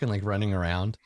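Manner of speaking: ordinary delivery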